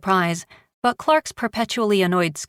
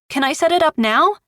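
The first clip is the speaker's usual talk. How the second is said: put-on excited boy; 9 semitones higher, 4 dB louder than usual. Boy thrilled with gift.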